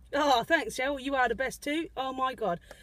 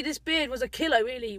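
ordinary delivery

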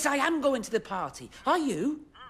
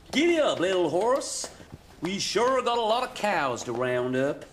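playing cowboy. Driver playing cowboy on a pretend horse, hammed-up yelling. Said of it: "Cowboys have horses".

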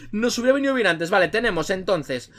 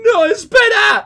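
high, loud, drawn-out shout; acted desperate cry; 11 semitones higher, 9 dB louder, much slower than usual. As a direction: call after someone in hammed-up despair. Lee el grito del personaje. (grito desesperado teatral, agudo y alargado)

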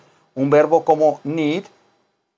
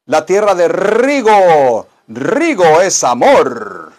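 announcer dedication; 5 dB louder, much slower than usual. Pretend. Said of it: "dedication in emphatic announcer manner".

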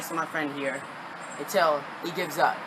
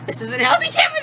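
much higher, louder squealed complaint; 4 dB louder than usual. Real, not acted. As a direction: squeal it out from the cold. Repeating while iced.

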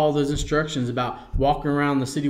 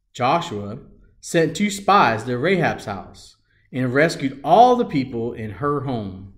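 ordinary talk, nothing marked

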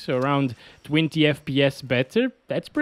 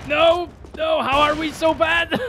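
exasperated; 12 semitones higher than usual. Real, not acted. Losing again.

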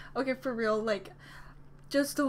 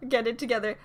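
usual speaking voice

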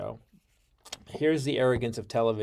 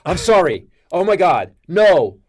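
panicked apology; 6 semitones higher, 11 dB louder than usual. Retold or imitated. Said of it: "I got cold and sweaty".

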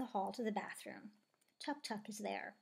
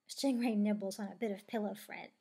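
ordinary delivery, nothing marked

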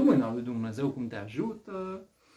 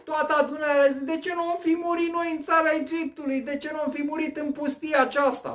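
grumbling crowd; 11 semitones higher, 5 dB louder than usual. Acted. Voicing the grumbling Israelites' complaint.

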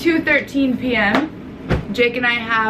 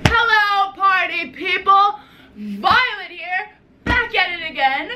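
high, drawn-out vlogger intro; hyped greeting; 7 semitones higher than usual. Acted. Vlogger greets viewers after jumping on the bed, hyped. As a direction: belt out a peppy, sing-out welcome. Over-the-top vlog opening greeting.

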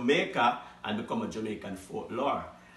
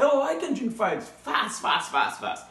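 eager boast; 7 semitones higher than usual. Acted. Dog boasts he drinks fastest.

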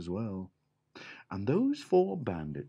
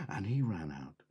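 ordinary delivery

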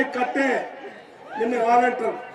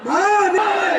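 slogan shout; 10 semitones higher than usual. Real, not acted. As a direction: shout నీళ్లు as a rally cry. Slogan called out.

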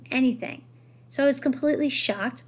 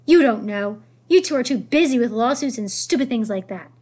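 loud, forceful telling-off; angry yell; 2 semitones higher, 7 dB louder than usual. A narrator's reading. Grandmother berating the boy's parents, angry yell. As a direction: keep yelling the accusation. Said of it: "yelled Grandemere".

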